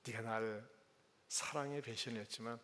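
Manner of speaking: tender question. Retold or imitated. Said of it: "물어보고 있는 것입니다".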